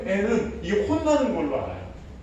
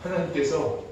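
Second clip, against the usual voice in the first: ordinary delivery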